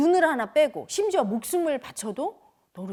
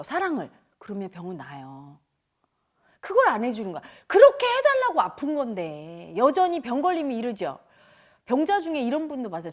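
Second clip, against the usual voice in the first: normal talking voice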